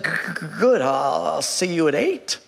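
put-on low, stammering old man; shaking elderly man. Old shop owner to job seeker, shaky stammer. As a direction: stutter haltingly on the first sounds. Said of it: "this man is kind of shaking".

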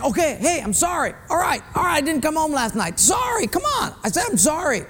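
high, pleading, exasperated apology, yelling; whiny brushed-off apology. Retold or imitated. Caught husband whining sorry at his wife, high and exasperated. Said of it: "It's sort of like saying".

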